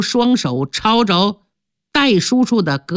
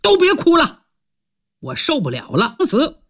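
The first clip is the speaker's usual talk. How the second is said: 大喊一声; 6 semitones higher than usual. Acted. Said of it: "突然有人大喊一声".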